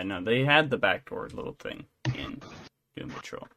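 ordinary talk, nothing marked

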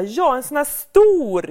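put-on high, loud and slow kid's voice; eager child. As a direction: show how big with wide eyes. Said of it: "hon bara". (dottern visar ivrigt hur stor)

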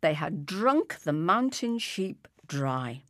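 ordinary delivery, nothing marked

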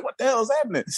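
high-pitched retold disbelief; bewildered. Two onlookers watching the argument, bewildered.

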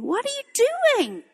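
high, bewildered plea to God; the prophet crying out, lightly voiced; Habakkuk's complaint. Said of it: "Habakkuk did the same thing".

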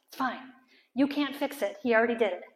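ordinary delivery

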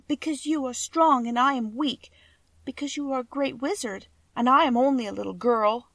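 a hint of a meek child asking for help; lightly voiced little girl. A narrator's reading. Dorothy voice.

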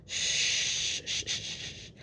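soft hushing shh; shushing. Retold or imitated. Kelly shushing a crying Alex, soft hushing. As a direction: hush her like a fussing child.

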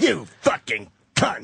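slow, drawn-out imitation of a raging ranter; Mel Gibson rant. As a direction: mimic that furious cadence, slowly and lightly. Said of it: "the cadence he gets on his voice".

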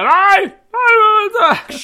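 high, loud panicked scream. Acted. Suspect screams at the cop to stop the radio call, high and loud. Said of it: "Och jag skriker".

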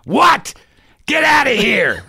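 high, loud raccoon squawk; angry raccoon voice. Pretend. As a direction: bark at them like a furious raccoon. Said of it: "You want me to do it for you?".

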